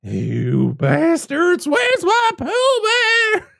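slow growl building to a high shout; farmer rage. Retold or imitated. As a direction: drag it out, then scream for the dog. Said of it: "He's like".

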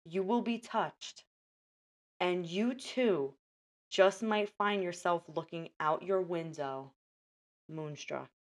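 dramatic, paused movie-trailer read; trailer voiceover. Acted. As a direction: sell it like a trailer.

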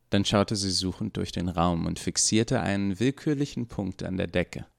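ordinary delivery

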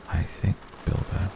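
hushed, careful address; gently hushed and deliberate; quiet. A narrator's reading. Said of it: "he said quietly".

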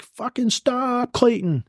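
high, drawn-out shout to the driver; retold yell at the driver in a firefight; urgent yell. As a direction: yell at Clayton to stop, stretching it out. Yelling at driver under fire.

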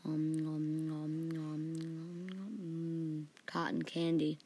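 low, soft sleep mumbling; hushed, droning dream-talk; very quiet. A narrator's reading. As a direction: hum munching sounds softly, as if dreaming. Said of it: "heard Archie very quietly".

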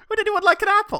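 high, laughing offer; very high chirpy question; upbeat Will Graham. Pretend. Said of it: "He's so upbeat".

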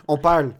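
"parle" with the R barely there; swallowed R. Shown as a demonstration. Podcaster showing how he and his brothers talk, swallowed R. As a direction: say "parle" with a slack, unrolled R. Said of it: "on dit même pas le R".

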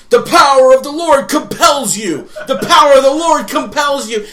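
hammy exorcist priest. Retold or imitated. Priest runs over to the possessed man, mock exorcism shout. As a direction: bellow it like a melodramatic priest. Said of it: "this priest runs over in priest garb and says".

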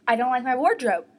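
mock-upset protest, pushed out loud; upset retort. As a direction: imitate a defensive complaint to mom. Retold crying outburst to mom.

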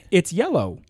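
unsure statement, YELLOW pitched high; questioning tone. Shown as a demonstration. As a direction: voice goes up on "yellow". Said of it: "see how my voice went up".

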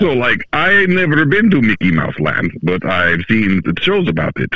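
put-on Russian accent. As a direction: play it as a Russian speaker. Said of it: "I didn't know you could do Russian accents".

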